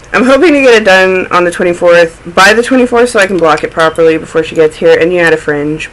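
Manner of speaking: usual speaking voice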